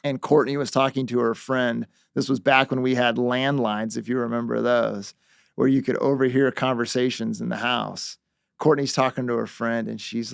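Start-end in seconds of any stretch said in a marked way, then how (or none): none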